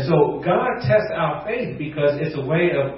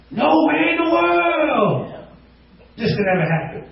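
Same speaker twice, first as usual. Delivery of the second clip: exaggerated refusal; 12 semitones higher than usual. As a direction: ham up the impossible excuse. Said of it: "we do this by saying things like".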